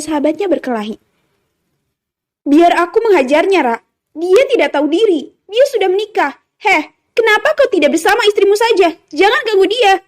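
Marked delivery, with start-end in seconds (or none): 2.4-10.0 high-pitched, indignant tirade; heated, rising rebuke; furious scolding. A narrator's reading. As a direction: lash out at your rival. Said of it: "hardik Vino dengan penuh amarah".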